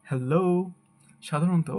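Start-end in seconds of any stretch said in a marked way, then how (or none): 0.1-0.4 "hello" with a long "loh-oo"; correct pronunciation. Shown as a demonstration. Teacher modelling the correct word, "hello" ending in o-u. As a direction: stretch the last syllable of "hello" into oo. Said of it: "সঠিক উচ্চারণ হবে".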